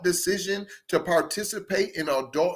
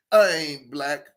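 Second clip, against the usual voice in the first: ordinary delivery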